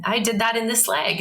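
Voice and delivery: put-on sing-song reassurance for a child; talking to a small child. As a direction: explain it sweetly to a little girl.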